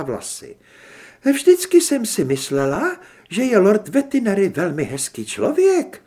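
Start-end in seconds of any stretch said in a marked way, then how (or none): 1.3-6.0 high, louder feminine voice; lady character speaking up; genteel lady character. A narrator's reading. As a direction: give the lady a raised pitch. Mrs Arkánová voiced in story.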